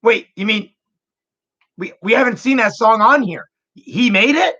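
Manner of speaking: high, eager disbelief rising at the end; a stranger stunned by the news; amazed shopper. Retold or imitated. Shoppers amazed brother made it.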